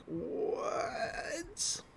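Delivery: hushed, drawn-out, breathy what; stunned disbelief. Retold or imitated. Everybody reacting to the shock kiss, stunned. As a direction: stretch it out in stunned near-silence.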